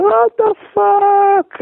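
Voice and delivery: drawn-out, high, loud what the fuck; stunned disbelief. Retold or imitated. Table flipped on him, he drawls in stunned disbelief. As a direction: stretch it out in shocked disbelief. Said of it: "and I'm like".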